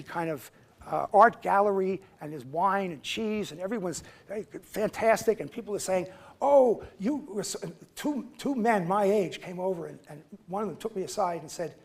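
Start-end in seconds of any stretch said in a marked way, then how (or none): 6.4-7.6 mock gushing praise, pitched higher; gushing admirer. Gallery guest gushing at the freedom rider. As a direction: fawn over him like an admirer.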